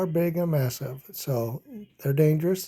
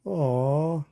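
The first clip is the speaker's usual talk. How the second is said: plain reading voice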